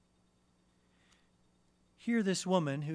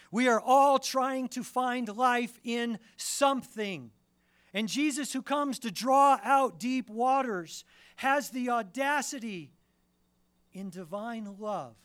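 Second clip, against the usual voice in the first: full-force preaching; 10 semitones higher, 11 dB louder than usual. Acted. Preacher urging the congregation, full-force preaching. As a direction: press the point home with full voice.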